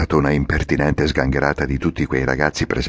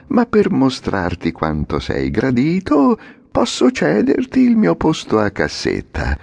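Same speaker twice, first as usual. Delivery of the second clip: lightly voiced coachman, slow and flattering; a hint of the oily little man; little man, sugary; 9 semitones higher than usual. (l'omino lusinghiero, mellifluo)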